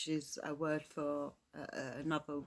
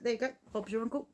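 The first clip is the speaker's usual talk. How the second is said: breezy job-done quip; 6 semitones higher, much faster than usual. Pretend. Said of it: "you're like".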